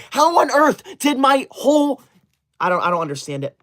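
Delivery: usual speaking voice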